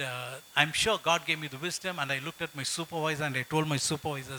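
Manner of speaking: normal talking voice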